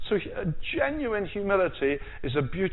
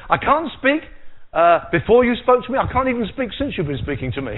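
high, loud whining excuse; mock Moses; 6 semitones higher, 7 dB louder than usual. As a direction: ham up Moses' helpless protest. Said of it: "He said".

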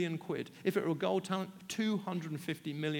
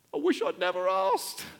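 rueful regret; 6 dB louder than usual. Acted. Peter regrets asking.

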